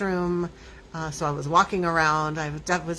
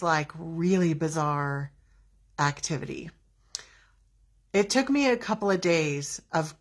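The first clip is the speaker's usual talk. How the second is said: ordinary talk, nothing marked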